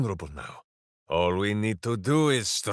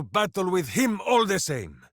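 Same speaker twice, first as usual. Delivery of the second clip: rousing shout; 5 semitones higher than usual. Acted. Minsk rallying about battling the demon, shouting. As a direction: build to a heroic shout.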